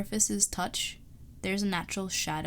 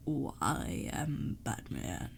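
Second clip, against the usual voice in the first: half-hearted deep superhero voice; deep Batman voice; 5 semitones lower, much slower than usual. Acted. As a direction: lower and very slow mock Batman. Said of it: "for the deep voice".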